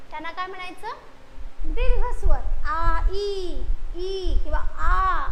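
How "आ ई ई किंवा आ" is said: आ and ई each held out long; slow, stretched आ and ई; long vowels. Shown as a demonstration. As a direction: draw out आ and ई slowly, one at a time. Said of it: "जास्त लांबीचा आहे".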